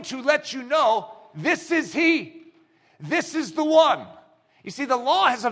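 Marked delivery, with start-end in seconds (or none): 1.3-4.1 solemn announcement. Retold or imitated. Forerunner announces the Messiah.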